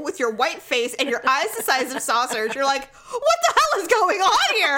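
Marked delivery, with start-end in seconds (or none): 2.9-4.8 high, loud panicked squeal; mock panicked squeal. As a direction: play the terrified sister, shrieking. Sister mimics scared sibling.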